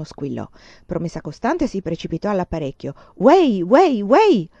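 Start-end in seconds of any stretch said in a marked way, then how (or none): 3.2-4.5 loud, high shout down the line; shouting into the phone. A narrator's reading. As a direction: yell it into the receiver. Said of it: "urlò nel ricevitore". (urlato al telefono, forte e acuto)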